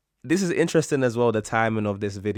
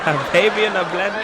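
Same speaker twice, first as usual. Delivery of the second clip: incredulous echo; 9 semitones higher, 6 dB louder than usual. Real, not acted. Stunned echo of the punchline.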